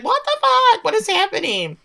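high, loud mock shriek; hammed-up bewildered yelp; freaked-out outburst. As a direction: squeal it out as an imitated panic. Retold Jackie locked in pantry, freaking out.

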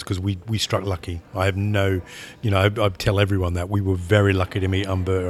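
ordinary talk

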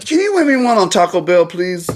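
high, loud pleading voice. Acted. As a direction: beg like a kid. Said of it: "begging request".

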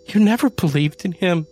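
bitter accusation. Acted. Janie accusing Adam, high bitter cry. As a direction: accuse him through grief.

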